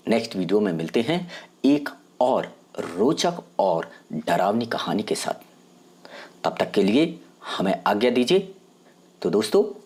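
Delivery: usual speaking voice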